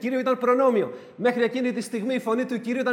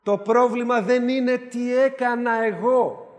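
δεν punched, slower and higher; emphatic slow; 4 semitones higher, 4 dB louder, slower than usual. Real, not acted. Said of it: "repeated with force".

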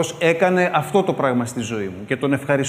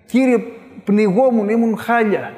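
anguished plea; 5 semitones higher, 3 dB louder, slower than usual. Retold or imitated. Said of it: "πόση αγωνία έβαζε".

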